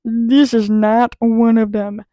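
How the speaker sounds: loud, slow declaration; big, drawn-out pronouncement; emphatic verdict. Real, not acted. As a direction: hammer it out slowly, word by word.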